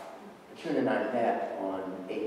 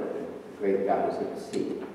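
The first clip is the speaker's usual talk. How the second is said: ordinary delivery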